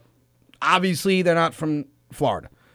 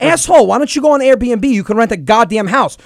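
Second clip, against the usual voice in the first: loud exasperated yell, pitched up; brother yelling; 7 semitones higher, 7 dB louder than usual. Retold or imitated. Brother yelling at him to use Airbnb, loud. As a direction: bark it at your dumb brother.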